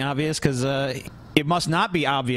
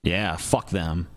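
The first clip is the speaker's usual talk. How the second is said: deadpan mock agreement; 11 semitones lower, 3 dB quieter, much slower than usual.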